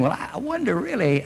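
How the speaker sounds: hammed-up hesitant musing; mock wondering. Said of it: "You couldn't go away saying".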